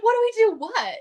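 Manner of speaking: high mock-baffled question; feigned innocence. Upline answering her accusation, acting baffled. Retold friend playing innocent.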